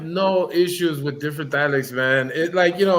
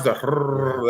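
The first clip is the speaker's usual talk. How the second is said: rolled R demo; 3 semitones lower than usual. Shown as a demonstration. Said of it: "doing the rolling R's".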